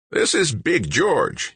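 slow, high grinding rasp; raspy old man. A narrator's reading. Old man introducing his big friend, slow rasp. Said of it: "His voice sounded like an old-time diesel rig".

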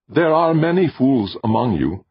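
high, level voice without reproach; flat alien voice. Acted. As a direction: state it without judgement. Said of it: "the voice said flatly".

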